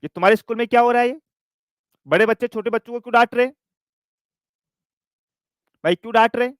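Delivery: a hint of an angry mum; mock scolding. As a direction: rattle it off like a cross mother ticking off the principal. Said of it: "principal को डांटा".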